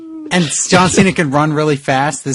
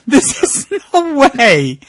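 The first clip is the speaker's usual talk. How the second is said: slow, high disbelief; stunned disbelief; 8 semitones higher, slower than usual. Real, not acted.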